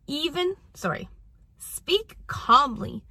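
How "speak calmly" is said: very slow, measured, evenly spaced; deliberate, unhurried instruction; calm speaking. Shown as a demonstration. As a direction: draw the words out calmly. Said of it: "lower your voice".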